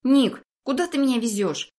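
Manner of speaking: put-on a high boy losing his swagger. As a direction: ask with the arrogance draining out. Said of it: "но уже не таким надменным голосом".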